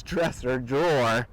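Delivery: plain reading voice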